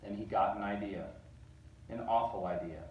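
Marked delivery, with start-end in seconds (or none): none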